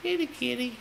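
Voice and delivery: high sing-song call to the cat; kitty call. Real, not acted. Calling the kitten from across the room, high and sweet. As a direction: coax the cat over in a high voice.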